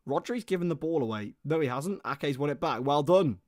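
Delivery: live match commentary building to the shot; play-by-play. Acted. Commentator calling the match for viewers, play-by-play. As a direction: call the attack as it happens.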